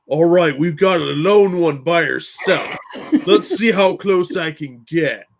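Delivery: slow, bad mock-Australian wildlife host; Crocodile Hunter. Wildlife host narrating his approach to a lone woman, badly faked Australian. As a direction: drawl it out like a stalking wildlife presenter. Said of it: "dressed up like the crocodile hunter going".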